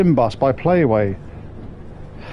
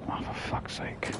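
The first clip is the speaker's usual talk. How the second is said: slow, breathy under-the-breath curse; exasperated whisper; 18 dB quieter, slower than usual. Real, not acted. To himself as the bus goes wrong again, slow exasperated whisper. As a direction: sigh it out in a whisper, fed up. Frustrated at game mistake.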